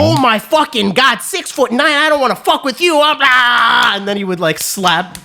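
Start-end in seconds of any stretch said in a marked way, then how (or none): 0.0-4.0 imitating a wired freak-out, high-pitched shouting; going crazy. Coked-out stranger reacting to six-foot-nine, going crazy. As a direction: shout it high, breaking down into gibberish. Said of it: "he was just going fucking crazy".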